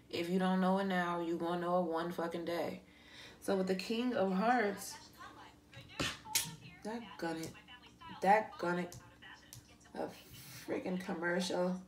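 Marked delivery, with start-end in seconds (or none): none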